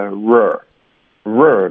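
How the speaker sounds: "r" as its own syllable, opening and ending on an r sound; isolated r syllable. Shown as a demonstration. Teacher breaks down poorer for students, demonstrating "r". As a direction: sound out "r" with r-colouring at both ends. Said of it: "我们需要再发一个r".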